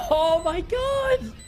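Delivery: slow, high disbelieving shout; amazed laughing. Real, not acted.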